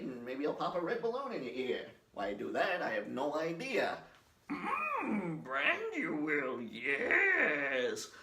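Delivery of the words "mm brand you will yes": high squeak then slow, odd-order wise creature; Yoda impression. Vlogger doing Yoda for viewers, slow and high. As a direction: croak it slowly like a tiny old sage.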